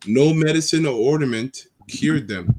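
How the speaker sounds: slow, solemn Bible reading; scripture reading. Acted. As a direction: read it like scripture. Reads scripture aloud.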